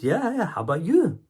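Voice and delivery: high-pitched, awkwardly eager reply; flustered small talk. Retold or imitated. Surprised customer answering shop girl, flustered. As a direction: act thrown by friendly small talk, voice jumping up.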